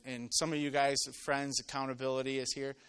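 ordinary talk

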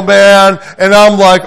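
normal talking voice

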